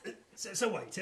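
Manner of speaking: usual speaking voice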